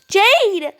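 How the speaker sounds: high loud warning cry; urgent shout. Acted. Girls calling to each other as the wind attacks, loud and high. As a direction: yell for your friend to flee. Calling as friend slides away.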